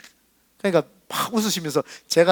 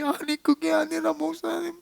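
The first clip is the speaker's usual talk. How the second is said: high, slow weepy nurse voice; imitated sobbing, high and soft; imitating tearful nurse; 10 semitones higher, 7 dB quieter than usual. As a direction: mimic a crying nurse protesting. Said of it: "막 우시는 거예요".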